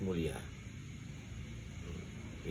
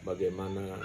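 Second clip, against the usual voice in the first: soft, low, devout prayer voice; quiet prayer; 4 semitones lower, 7 dB quieter than usual. Shown as a demonstration. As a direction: pray humbly under the breath. Models a husband's prayer. (doa lirih penuh khusyuk)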